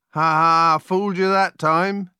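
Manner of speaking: high, loud triumphant crowing; gleeful gloating. A narrator's reading. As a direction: crow over a successful prank. Prankster wizard gloats over twins.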